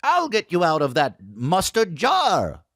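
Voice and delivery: high, slow cartoonish hero voice; hammed-up kids' YouTube performer; kids' show host. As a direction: draw it out in a silly high voice. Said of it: "blue wig just going like".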